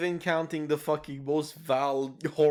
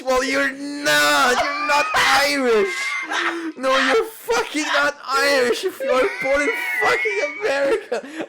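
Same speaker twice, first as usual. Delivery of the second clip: higher, louder hammed-up shouting rant; mock furious rant; 7 semitones higher, 4 dB louder than usual. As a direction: rant like a furious Irishman, half laughing. Hammed-up rant about fake Irish.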